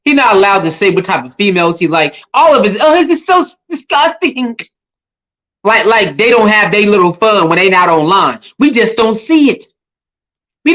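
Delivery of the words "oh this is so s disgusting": high, exaggerated mock revulsion; mock disgust. Mimicking critics of his flirting, mock disgust. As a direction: imitate a scandalized critic, pitched way up.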